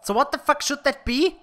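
high, puzzled question; baffled. Real, not acted. Puzzled at strange room.

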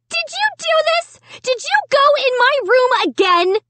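high, shrill-pitched accusing yell; screaming accusation. A narrator's reading. Sarah accusing her brother, high screamed questions. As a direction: hurl the questions at him. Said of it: "Sarah screamed".

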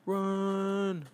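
high urgent cry; cry to run. Acted. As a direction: yell at yourself to flee.

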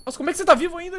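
stunned that his friend is still alive; amazed outcry. Real, not acted. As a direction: shout out in pure disbelief. Friend survives against the odds.

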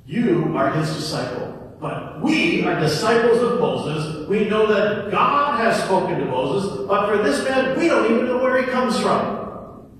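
loud, high scornful attack; reviling. Acted. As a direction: hurl the insult at him. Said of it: "they reviled against him".

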